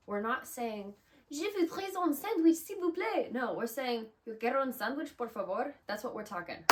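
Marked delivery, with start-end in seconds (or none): 1.3-3.3 high, loud hammed-up French; mock French, pitched way up and belted; French speech. Shown as a demonstration. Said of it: "You're not talking French". 4.3-5.7 loud, higher Spanish accent. Shown as a demonstration. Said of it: "We're talking like that".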